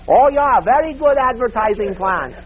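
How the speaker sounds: high, loud broken-English echo; recalling the saleswoman's accented pitch. Retold or imitated. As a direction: repeat the accented pitch, laughing at it. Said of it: "that's the way this lady was talking".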